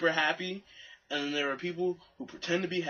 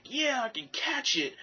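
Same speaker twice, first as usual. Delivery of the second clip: much higher, eager and confident; cocky excitement; 11 semitones higher than usual. Retold or imitated. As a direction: psych yourself up for the catch. Confident before catching.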